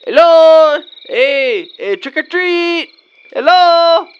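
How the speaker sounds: loud, high sing-song call, drawn out slowly; calling at the door. Acted. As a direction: stretch each word out like calling up to a window.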